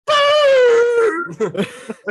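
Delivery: long, high, loud howling cry; imitated dog howl. Host mimics his dog seeing a fallen branch, howling. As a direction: wail like an alarmed little dog. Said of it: "and then goes".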